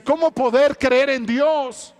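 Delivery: a father who lost his daughter crying out; anguished plea to heaven; grieving father's cry. Acted. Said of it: "Y él dice".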